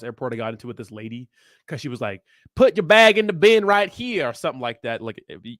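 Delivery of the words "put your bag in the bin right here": slow, louder, higher Texan twang imitation; imitated Texan accent. Imitating a TSA lady ordering him at Dallas, Texan accent. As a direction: mimic a bossy Texas airport agent. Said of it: "guess what she looked like based off that accent".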